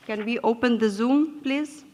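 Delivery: ordinary talk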